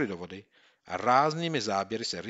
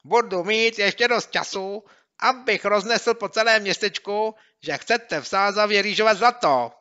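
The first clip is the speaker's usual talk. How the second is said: much higher, louder, gleeful; gloating taunt; 12 semitones higher, 12 dB louder than usual. Acted. Bully gloating over the tricked boys, gleeful. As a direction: gloat at them.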